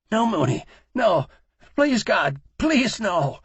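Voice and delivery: high, loud frightened begging; pleading in terror. A narrator's reading. Terrified man pleading at gunpoint.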